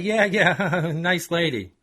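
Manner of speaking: laughing it off; mildly forced laughter; nervous laugh. Acted. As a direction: brush it off with a laugh. Chuck laughs it off.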